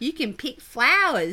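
loud, high mock Aussie accent. Teasing co-host about ugly Aussie accent, mock Aussie accent. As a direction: exaggerate the Australian vowels in flowers. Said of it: "then an Aussie accent".

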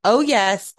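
ordinary delivery

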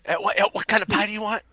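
high, fast mock dealer. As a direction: squeak it out as a dealer.

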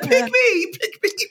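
put-on high, excited pleading kid voice; eager begging. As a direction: beg to be picked like an eager child. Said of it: "I was like".

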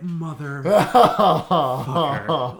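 loud, drawn-out furious curse; a big game-over swear; angry outburst. Retold or imitated. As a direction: spit it out long and loud, fuming. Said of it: "I was so upset".

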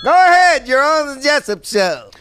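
high, loud announcer burst; shouted on-air greeting. Acted. Host puts the next caller on air, shouted announcer greeting. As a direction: belt out the first words to the caller.

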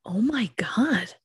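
a horrified hush; shocked whisper. Real, not acted. Listener horrified at the sisters' punishment, whispering. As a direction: breathe it out in disbelief, slowly and unvoiced.

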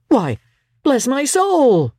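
high, slow astonished exclamation; surprised cry. A narrator's reading. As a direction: caught off guard by a surprise guest. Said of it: "cried Fred".

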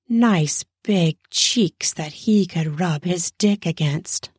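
usual speaking voice

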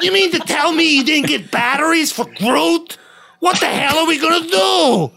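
Rocket Raccoon impression. Joking to friends as Rocket, high frantic shout. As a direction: yell it like a panicked raccoon. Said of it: "he just wanted to do his accent".

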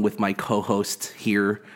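normal talking voice

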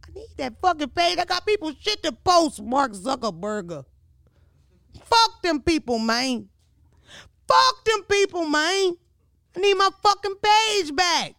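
high, loud outraged yelling; furious rant. Real, not acted. Angry at Mark Zuckerberg over her lost page, furious yelling. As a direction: yell it out like you've been robbed. Ranting about lost Instagram.

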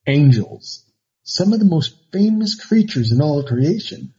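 dramatic narration. Acted. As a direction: build mystery like a trailer. Dramatic topic intro.

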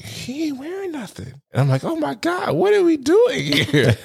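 high disbelief. Retold or imitated. Retelling the club scene, high shocked squeal. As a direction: squeal it, scandalised and giggling.

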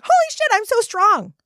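high, thrilled outburst; elated realization. Retold or imitated. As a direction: act out a client amazed at her own strength. Client's breakthrough acted out.

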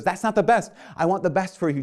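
high, loud objection; insistent protest. Retold or imitated. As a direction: voice a friend protesting urgently on your behalf. Peter protesting out of love.

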